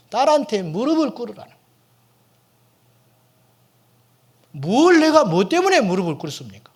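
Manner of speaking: loud, high, indignant protest against the command. Retold or imitated. Pastor protesting loudly to God about kneeling to his daughter, indignant. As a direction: push back hard, outraged.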